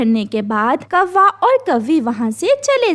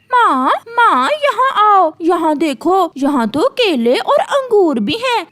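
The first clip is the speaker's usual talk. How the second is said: excited calling; 5 semitones higher than usual. Acted. Crow calls mother excitedly.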